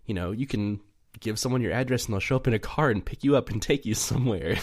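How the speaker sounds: ordinary talk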